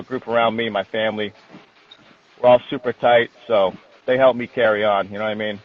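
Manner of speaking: normal talking voice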